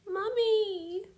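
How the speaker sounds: put-on high, loud child's wail; wailing toddler. A narrator's reading. Toddler wailing after her mummy, high and loud. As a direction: cry out for mummy like a sobbing baby. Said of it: "She wailed".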